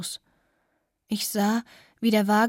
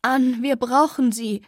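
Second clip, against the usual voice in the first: pleading; 4 semitones higher, 6 dB louder than usual. Acted. Begging Anne to stay.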